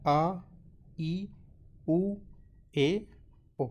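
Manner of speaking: आ ई उ ए ओ said crisply, one by one; teaching the vowels. Shown as a demonstration. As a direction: model आ, ई, उ, ए, ओ slowly for students to copy. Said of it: "तो इनको pronounce कैसे करना है?".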